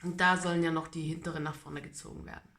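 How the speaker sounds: normal talking voice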